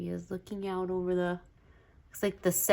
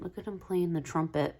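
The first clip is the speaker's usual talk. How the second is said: ordinary talk, nothing marked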